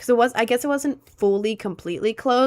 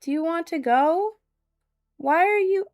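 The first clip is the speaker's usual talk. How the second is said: higher sweet pet-talk voice; talking to the cat; 7 semitones higher than usual. Real, not acted. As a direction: ask the cat gently, pitched up. Asking her cat if it wants out.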